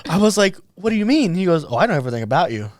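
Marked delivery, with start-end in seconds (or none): 0.8-1.4 high, startled question; baffled and a bit scared; surprised customer asking what he means; alarmed confusion. Retold or imitated. Confused reply to stranger. 1.7-2.7 fast, offhand know-it-all; rapid creepy claim. Retold or imitated.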